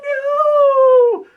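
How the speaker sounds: very high, loud, drawn-out wail; wailing children. Acted. Kids protest at dad's verdict, high mock wail.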